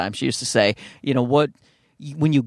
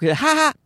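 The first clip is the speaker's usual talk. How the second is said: short high mock laugh burst; mock audience laugh; 13 semitones higher than usual. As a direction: laugh like a crowd, then stop. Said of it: "they'll laugh pretty big".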